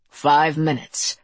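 slow, cold crime boss. Acted. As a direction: toy with him coldly. Crime boss sets cruel terms.

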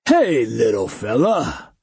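slow, hearty big man; mock a small guy, jovially; jolly giant. A narrator's reading. Cyborg giant voiced.

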